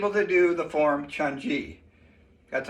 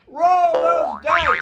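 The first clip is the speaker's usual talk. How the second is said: excited announcer; 10 semitones higher, 6 dB louder, slower than usual. Acted. Hyping the dice roll.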